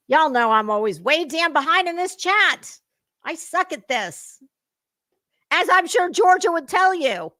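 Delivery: loud, high, self-mocking exclamation; playful loud self-roast. Real, not acted. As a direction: shout out how hopeless you are at this. Laughing at own slowness in chat.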